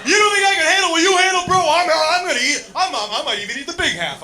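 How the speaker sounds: loud, high, fast offended boast; indignant brother. Retold or imitated. Brother insisting he can handle the edible, loud bluster. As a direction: bluster back to prove you can handle it.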